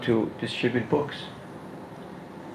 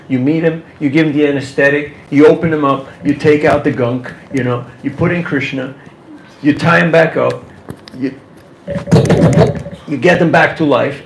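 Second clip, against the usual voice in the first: louder with each step; building excitement; 7 dB louder than usual. Real, not acted. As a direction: drive the surgery steps home, punchy. Open heart surgery metaphor, building.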